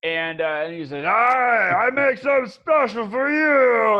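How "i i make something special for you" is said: high, loud broken-English troll. Acted. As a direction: boom it out like an eager troll cook. Troll NPC speaks.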